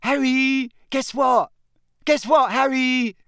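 high, loud, eager pestering; breathless young fan calling out; highly excited. Acted. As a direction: burst to share news with an idol. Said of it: "a highly excited, breathless voice".

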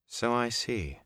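understated, soft flat reply. A narrator's reading. Moraine answers Rand's news, in a flat voice. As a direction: answer softly without feeling. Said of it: "Moraine said in a flat voice".

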